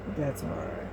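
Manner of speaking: normal talking voice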